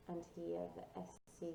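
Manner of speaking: ordinary delivery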